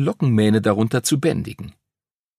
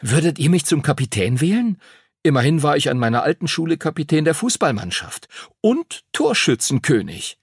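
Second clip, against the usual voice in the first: put-on higher-pitched, cheeky boy's voice; young lad boasting lightly; self-promoting schoolboy; 6 semitones higher than usual. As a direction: pitch yourself as captain with boyish pride. Henry pitches himself as captain.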